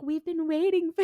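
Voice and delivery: high, put-on come-on; hammed-up high temptress voice; mock seductresses. Two women greeting Dean from the bed, mock seductive. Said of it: "they're trying so hard".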